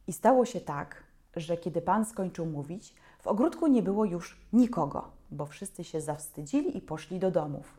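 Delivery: plain reading voice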